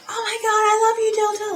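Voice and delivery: high-pitched imitation of screaming fans; mock fan scream. Imitating the crowd all night at the concert, mock screaming. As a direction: squeal it high without real volume. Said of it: "like, screaming it out".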